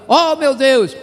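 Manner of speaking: a light acted cry of shock; as someone hit by sudden news, half-acted; mock alarmed exclamation. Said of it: "E em português fala".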